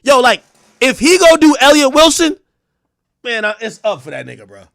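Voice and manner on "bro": usual speaking voice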